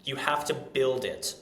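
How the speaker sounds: ordinary delivery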